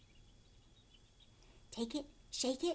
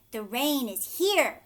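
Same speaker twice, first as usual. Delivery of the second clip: loud, eager rhino announcing the rain; rhino's excited call; 3 semitones higher, 12 dB louder, slower than usual. Acted. Rhino tells the animals the rain has come, loud and eager. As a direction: boom it out like a big rhino with news. Rhino voiced in read-aloud story.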